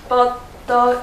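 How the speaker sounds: normal talking voice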